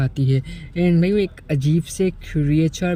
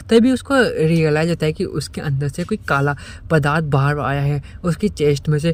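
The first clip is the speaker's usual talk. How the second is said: ordinary delivery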